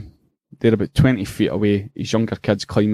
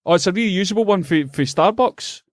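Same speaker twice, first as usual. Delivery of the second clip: high, whiny mock of the stranger; mocking whine; 9 semitones higher than usual. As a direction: whine it like a petty fool.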